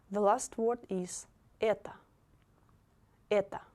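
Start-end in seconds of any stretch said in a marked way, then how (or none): 1.6-3.6 это said "E-ta"; final O as A. Shown as a demonstration. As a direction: model это with the unstressed O as "ah". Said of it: "It will be это".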